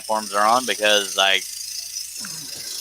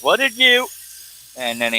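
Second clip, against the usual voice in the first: cut-off stream audio; 12 semitones higher than usual. Retold or imitated. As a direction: imitate a frozen stream cutting out. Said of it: "all I heard is".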